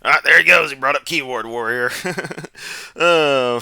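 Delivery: loud, high amused outburst; delighted jeer ending in laughter; laughing mockery. Real, not acted. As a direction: crow over it, breaking into a laugh. Amused as clip says keyboard warrior.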